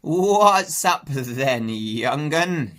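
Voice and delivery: put-on an old country sheep croaking; Ma the old ewe questioning the pig. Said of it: "she croaked".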